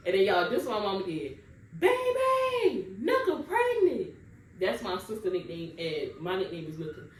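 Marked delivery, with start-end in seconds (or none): 1.8-4.1 much higher, sing-song calling voice; mom calling out. Retold or imitated. Mom calling the family about the pregnancy, high sing-song. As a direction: call it up the house like a mother announcing news.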